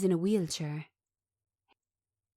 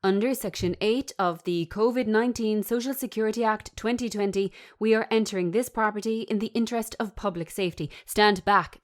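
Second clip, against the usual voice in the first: loud, commanding official announcement; barking official, raised voice; official order; 4 semitones higher, 6 dB louder than usual. Acted. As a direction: read him his rights like a stern officer. Official entering the property.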